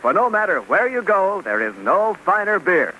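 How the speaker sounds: ordinary delivery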